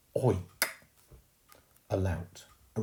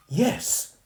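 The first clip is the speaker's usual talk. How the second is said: high, impatient yes; girl getting fed up; irritated yes; 14 semitones higher, 7 dB louder than usual. Acted. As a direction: snap it out impatiently. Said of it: "becoming a tiny bit irritated".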